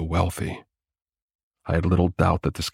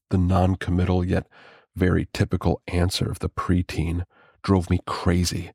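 ordinary delivery

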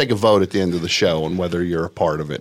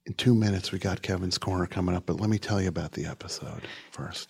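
soft demo; 7 dB quieter than usual. Shown as a demonstration.

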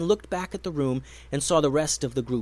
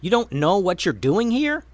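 higher, raised disbelief; incredulous question; 6 semitones higher, 4 dB louder than usual. A narrator's reading. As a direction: ask in amazement. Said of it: "asked Tatiana".

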